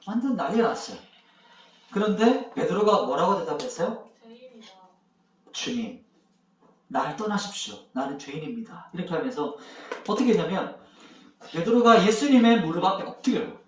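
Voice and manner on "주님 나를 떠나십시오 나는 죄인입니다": a softer, lower plea; bow down and say it softly; a hint of Peter kneeling in shame; humble confession. Peter kneels, confessing himself a sinner.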